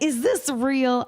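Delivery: slow, high, wondering question; mock amazed disbelief. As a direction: draw it out in acted wonder. Acting out disbelief at the offer.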